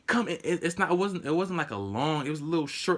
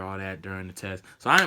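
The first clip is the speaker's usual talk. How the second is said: normal talking voice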